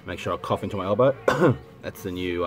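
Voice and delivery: ordinary talk, nothing marked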